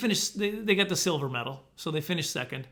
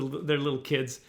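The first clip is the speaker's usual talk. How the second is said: ordinary talk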